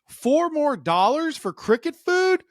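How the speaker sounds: high, slow incredulous squawk; can't believe the price; outraged disbelief. Retold or imitated. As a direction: pitch shoots up in shocked disbelief.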